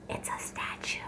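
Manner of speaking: hushed whispered aside. A narrator's reading. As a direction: whisper it like a secret to the listener. Aside revealing the bird is statue.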